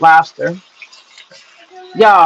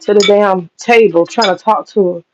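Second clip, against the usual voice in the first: ordinary delivery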